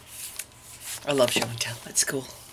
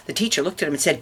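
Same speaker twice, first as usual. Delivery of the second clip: plain reading voice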